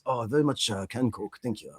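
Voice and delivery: hushed, formal Japanese accent; mock Japanese accent. Shown as a demonstration. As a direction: order the Coke with grave Japanese-accent politeness. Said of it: "when you do a Japanese accent".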